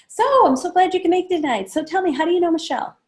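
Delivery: bright, high and quick small talk; eager host making conversation; friendly hostess question. Shown as a demonstration. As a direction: chirp it at the guest. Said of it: "I will say".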